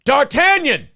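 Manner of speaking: high, drawn-out calling; mock calling out. Host imitating someone searching for a kid, mock high call. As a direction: holler the name as if searching. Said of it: "and you're like".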